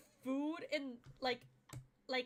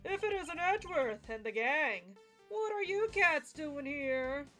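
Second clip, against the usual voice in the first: smooth rogue voice; 4 semitones higher, 6 dB louder, slower than usual. Acted. Fender greets the gang in character.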